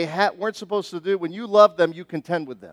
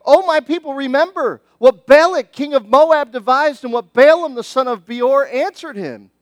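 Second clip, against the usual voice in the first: louder, higher call to his people; God pleading loudly; 5 semitones higher, 8 dB louder than usual. Acted. God calling his people loudly to remember. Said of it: "he says".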